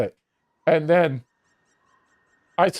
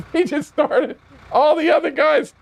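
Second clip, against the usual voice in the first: usual speaking voice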